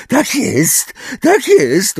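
very high, squeaky voice; Umbridge. A narrator's reading. Umbridge answers Magorian, even squeakier. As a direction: squeal it in a thin high pitch. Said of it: "jeszcze bardziej piskliwym głosem".

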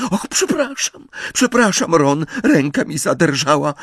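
high, flustered apology; panicked apology. A narrator's reading. Girl who just cut her friend's leg, frantically apologising. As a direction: blurt out apologies in a high, distressed voice.

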